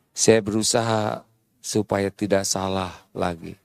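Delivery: husband calmly answering angry wife. Retold or imitated. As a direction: soothe her gently, low and quiet. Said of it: "suami berkata begini".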